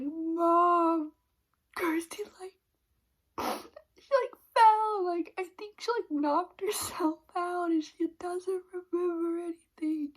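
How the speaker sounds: a mocking whiny wail of worry; mock panicked sobbing. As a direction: imitate him blubbering to his mother. Husband crying to his mom, retold.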